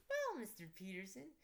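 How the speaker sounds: high voice, pointed on pleasure; a sly put-down to the father; Frankie's cold greeting. Acted. Frankie snubs the dad with a high, sly voice. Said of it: "Frankie said".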